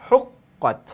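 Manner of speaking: হুক্কত said high with the ক্ক clearly doubled; doubled consonant stressed. Shown as a demonstration. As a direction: lean hard on the double ক in হুক্কত. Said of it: "তাহলে কি হলো?".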